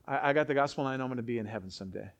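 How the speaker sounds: low, quick, offhand imitation; mock complacency. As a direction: rattle it off softly as a complacent believer. Voices a complacent believer.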